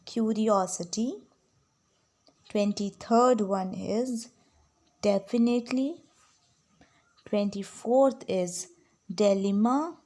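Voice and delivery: slow, deliberate dictation voice with long gaps. Acted. Teacher dictating spelling words to her students, very slow and clear. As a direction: read each word out very slowly so students can write.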